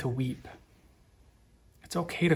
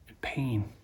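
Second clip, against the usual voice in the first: whisper; 7 dB quieter than usual. Acted. Sermon on death and pain.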